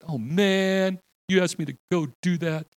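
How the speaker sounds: put-on high whiny teenage complaint; whiny teenager. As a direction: whine like a teen sent on an errand. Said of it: "So you teenagers".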